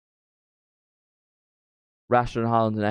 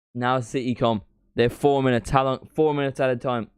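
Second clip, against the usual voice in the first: higher, louder excited match commentary; live play-by-play; 4 semitones higher, 4 dB louder than usual. Acted. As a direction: call the final minutes like a TV commentator.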